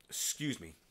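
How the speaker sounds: ordinary talk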